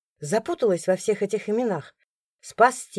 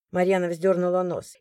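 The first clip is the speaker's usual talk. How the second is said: usual speaking voice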